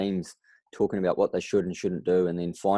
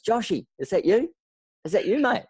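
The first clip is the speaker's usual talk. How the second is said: put-on much higher, thrilled call to an old mate; excited friend's greeting; 14 semitones higher, 3 dB louder than usual. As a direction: greet him high and delighted, like finding treasure. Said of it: "he just goes".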